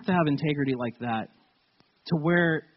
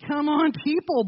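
mild retold gripe; 9 semitones higher than usual. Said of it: "Thank God I only said".

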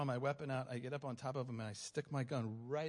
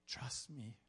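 softer, hushed reassurance; voice of the Lord; 7 dB quieter than usual. Retold or imitated. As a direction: say it softly, as quiet reassurance. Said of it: "the Lord's just like".

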